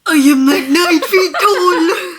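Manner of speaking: booming, very loud mock Russian; hammed-up Russian giant, breaking into laughter; strong Russian accent. Shown as a demonstration. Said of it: "Russians, they're like Germans".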